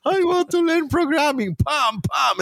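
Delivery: put-on kid's high-pitched yelp of a plea; high pleading shout. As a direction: shout it high and desperate, a kid begging. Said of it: "And I was like".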